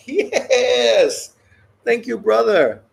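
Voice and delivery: high, loud laughing cheer; excited cheer. Retold or imitated. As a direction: burst out in delighted thanks. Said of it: "They're gonna be like".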